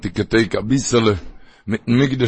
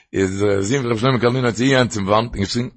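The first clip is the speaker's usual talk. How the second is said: ordinary talk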